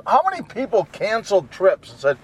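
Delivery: ordinary talk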